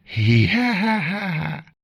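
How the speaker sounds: slow, high eerie ghost laugh; ghostly cackle. Acted. Ghost cackling slowly at the frightened villagers. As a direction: drag out a spooky cackle from the bushes.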